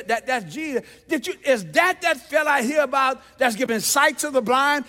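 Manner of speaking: loud, insistent questions from the blind man; excited questioning. Acted. Blind beggar asking passersby about Jesus, loud and excited. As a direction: fire off questions in mounting excitement.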